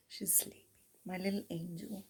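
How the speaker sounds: soft whisper so as not to wake her. Real, not acted. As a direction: hush it down to a whisper over a sleeping pet. Dog asleep, not waking her.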